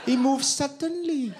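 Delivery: high, lilting Welsh; Welsh accent. Shown as a demonstration. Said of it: "Welsh, no problem".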